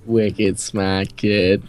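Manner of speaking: slow, stretched mock Boston accent. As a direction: exaggerate a drawling Boston accent. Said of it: "if they hear a Boston accent".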